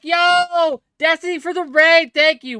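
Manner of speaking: loud, high cheer for the raid; excited shout-out. Real, not acted. Streamer thanking Destiny for the raid, excited shout.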